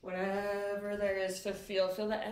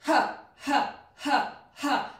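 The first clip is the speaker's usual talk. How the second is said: sharp panting exhales; 3 semitones higher, 5 dB louder, slower than usual. Shown as a demonstration. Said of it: "like a dog panting".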